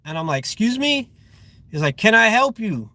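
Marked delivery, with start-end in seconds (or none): 0.4-1.1 taken-aback question, pitched much higher; high, incredulous comeback in a retelling; retold incredulous reply. Retold reply to driver's challenge. 2.0-2.9 imitating the driver's challenge, higher pitch; mock confrontational, voice raised in pitch; retold challenge.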